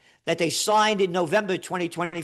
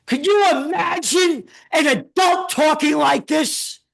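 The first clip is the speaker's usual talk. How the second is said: hammed-up shocked critic, louder and higher; mock outraged shout; 7 semitones higher, 5 dB louder than usual. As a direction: bellow it like a pearl-clutching critic. Mimics critic scolding him.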